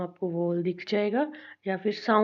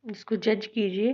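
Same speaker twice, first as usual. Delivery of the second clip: ordinary talk, nothing marked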